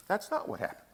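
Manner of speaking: soft hushed first take; hushed aside. Acted. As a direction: let it fall away, barely voiced. Repeats line, first hushed.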